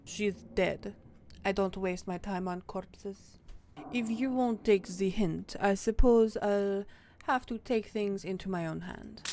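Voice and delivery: cold, haughty cat in bad French accent; mock-French snooty cat voice. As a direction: threaten in a hammed-up French accent. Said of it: "Frou Frou has a French accent".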